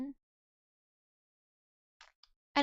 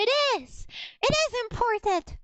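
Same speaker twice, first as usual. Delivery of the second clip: high, loud, insistent protest; emphatic insistence; 9 semitones higher, 4 dB louder than usual. Real, not acted. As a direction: insist as if nobody believes you. Insisting on the visual reference.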